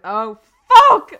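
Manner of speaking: laughing panic. Retold or imitated. Realises she's caught, retold.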